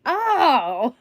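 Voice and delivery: a loud bright "Oh!" of sudden understanding; a loud, hammed-up "Oh!" as it finally clicks; mock realisation. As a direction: play the penny dropping, out loud. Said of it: "I'm gonna be like".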